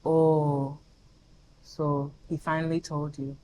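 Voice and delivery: low, soft admission; sheepish best friend. Retold or imitated. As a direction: let it out low and subdued.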